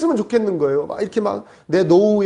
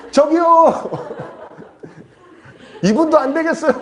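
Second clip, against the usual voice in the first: comic calling out; 9 semitones higher than usual. Retold or imitated. Stranded pastor seeking another rescuer, comic high call. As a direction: hail a stranger over God's head.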